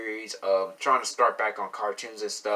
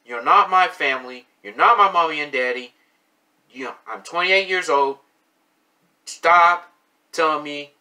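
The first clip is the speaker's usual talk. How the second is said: rising, louder angry lecture; heated, louder and higher scolding at online critics; real angry rant; 3 semitones higher, 6 dB louder than usual. Real, not acted. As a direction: push back hard at the people judging you. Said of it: "I'm sorry for even cussing out on this video".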